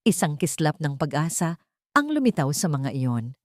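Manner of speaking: ordinary delivery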